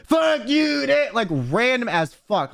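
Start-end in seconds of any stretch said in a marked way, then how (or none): 0.1-1.1 abrupt high mock yell; sudden scream. Passenger cutting himself off to scream, sudden mock yell. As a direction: burst out mid-sentence, imitating a random scream. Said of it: "that's how sudden it was".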